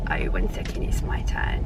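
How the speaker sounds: hushed whisper to the camera. Real, not acted. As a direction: drop to a whisper before ordering. Whispering while in queue.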